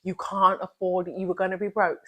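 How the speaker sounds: soft, fast murmur of self-doubt; hushed and hurried, like a nagging inner voice; inner negative voice. Retold or imitated. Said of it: "those thoughts are telling you".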